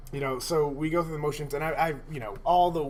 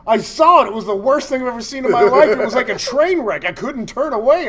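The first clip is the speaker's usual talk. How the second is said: hammed-up horrified witness; 7 semitones higher, 12 dB louder than usual. Imitating his buddy's horror story, a loud hammed-up lament. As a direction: overplay the shocked eyewitness. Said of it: "did you hear about it".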